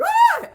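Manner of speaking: short, sudden, very high startled scream; imitated fright scream. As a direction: shriek like a panicked animal. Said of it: "what makes my amygdala excited, like".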